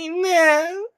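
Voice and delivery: high-pitched mimicked "no"; imitated high cry of no; mimicked protest. Mimicking woman in the movie fight.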